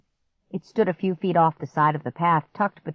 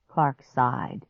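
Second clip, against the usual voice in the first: plain reading voice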